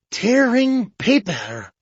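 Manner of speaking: mild triumphant reveal; excited. A narrator's reading. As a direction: announce the discovery with glee. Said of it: "His excitement communicated itself".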